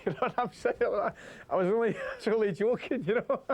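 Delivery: laughing through the words, pitch jumping up; cracking up as he tells it; real giggles breaking into the story. Real, not acted. Laughing while retelling cell story.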